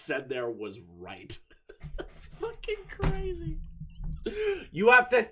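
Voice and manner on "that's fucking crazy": high, soft squeezed laughing words; helpless giggly disbelief. Real, not acted. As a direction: say it slowly through real laughter. Laughing at the clip.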